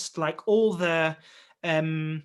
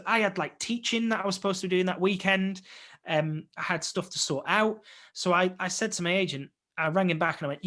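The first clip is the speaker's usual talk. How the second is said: ordinary talk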